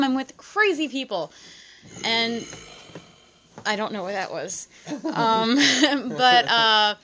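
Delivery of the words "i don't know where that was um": usual speaking voice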